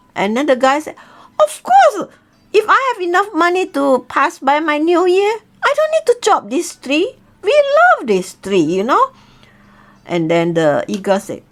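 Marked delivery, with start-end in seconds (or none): 1.4-9.1 much higher, eager yes; poor man eagerly agreeing to the eagle's deal, high and keen; eager agreement. Acted. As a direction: jump at the offer.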